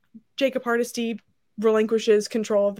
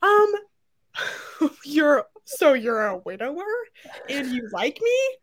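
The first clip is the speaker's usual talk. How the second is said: awkward; 4 semitones higher than usual. Retold or imitated. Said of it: "Marquesa's like".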